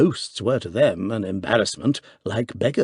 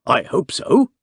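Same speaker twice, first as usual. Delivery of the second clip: some warmth; 7 semitones higher, 4 dB louder, slower than usual. A narrator's reading. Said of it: "with some warmth".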